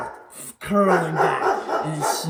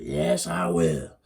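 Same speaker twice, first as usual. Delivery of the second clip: much lower, slow growled yes; hairy man; 11 semitones lower, much slower than usual. Acted. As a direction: drop deep and drawn out.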